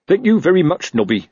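high start dropping away; sour, sarcastic thanks; bitter thanks. A narrator's reading. Sergeant rebuffing his friend's suggestion, mildly bitter. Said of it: "he said bitterly".

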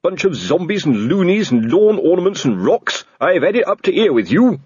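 angry shout. Acted. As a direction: blow up at the cheeky constables. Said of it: "and shouted".

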